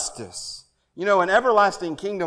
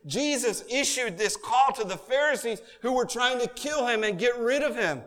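usual speaking voice

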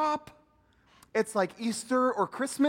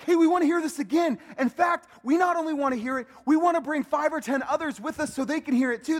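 eager pleading; 7 semitones higher, 6 dB louder, faster than usual. Retold or imitated. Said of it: "That means they pleaded".

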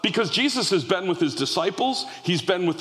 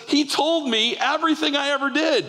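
excited testimony; 9 semitones higher than usual. Retold or imitated. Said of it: "She says".

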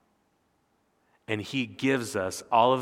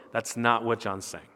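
normal talking voice

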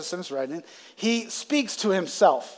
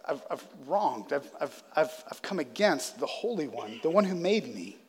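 lower, softer, halting remorse; remorseful confession; 4 semitones lower, 6 dB quieter than usual. Retold or imitated. A sinner confesses wronging God, low and halting. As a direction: stumble through it ashamed. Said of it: "a feeling of".

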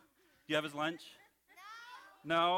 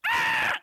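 very high, loud shriek; sudden piercing puppet scream; 12 dB louder than usual. Pretend. Puppet mishearing screen as scream, full high shriek. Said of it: "I didn't tell you to scream".

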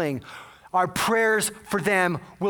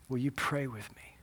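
very soft, low request; quiet retold plea to pray; hushed plea; 4 semitones lower, 10 dB quieter than usual. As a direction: ask gently, almost under the breath. Doomed caller asks for prayer.

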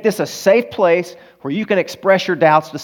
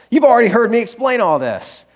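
higher, indignant mock rebuke; mock scolding; 9 semitones higher than usual. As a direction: replay the scolding with raised pitch. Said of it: "I raked her across the coals".

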